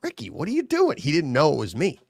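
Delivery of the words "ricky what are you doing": startled plea. Retold or imitated. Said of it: "I'm like".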